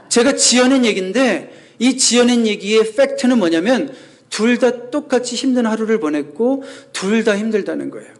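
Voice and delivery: usual speaking voice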